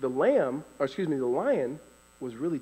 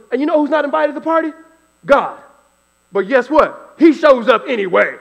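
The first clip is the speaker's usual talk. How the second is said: booming preacher voice, much higher and louder, fast; imitating the preacher; 10 semitones higher, 14 dB louder than usual. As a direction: preach it out full force to the whole church. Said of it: "And he goes".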